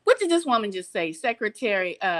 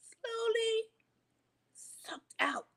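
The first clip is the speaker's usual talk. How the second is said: dramatic whisper; 9 semitones higher, 7 dB quieter, much slower than usual. Acted. Warning viewers about lost rights, dropping to a whisper. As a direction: whisper it, fading away.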